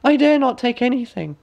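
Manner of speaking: put-on high timid girlish voice; young girl. A narrator's reading. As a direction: hold back like a cautious princess.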